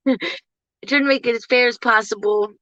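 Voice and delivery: ordinary talk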